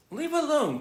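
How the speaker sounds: high sharp order; commanding. Acted. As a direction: tell him off. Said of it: "commanded Fern".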